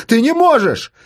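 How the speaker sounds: high, alarmed shout; crying out. Acted. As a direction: shout in disbelief and panic. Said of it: "кричал Рома".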